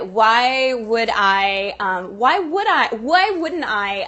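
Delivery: slow, stretched-out questioning; questioner asking why. Acted. Questioner weighing whether to cross the street, drawn-out questioning. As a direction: draw out each why, challenging the rule. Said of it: "The questioner".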